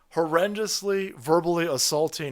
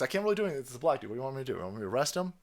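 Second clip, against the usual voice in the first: soft, shrugging cop voice, softer; mock helpless cop; 3 semitones lower, 7 dB quieter, much faster than usual. The cop excusing himself to the victim, soft mock voice. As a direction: play the cop who won't act.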